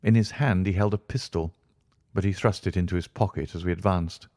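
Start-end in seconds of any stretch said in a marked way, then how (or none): none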